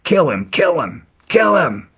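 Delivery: high-pitched egging-on cry, imitated; mock yelling. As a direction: imitate someone yelling from the sidelines. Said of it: "started yelling out".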